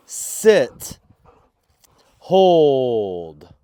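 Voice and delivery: loud clipped commands to a dog; firm command. Real, not acted. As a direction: order the dog firmly and loudly.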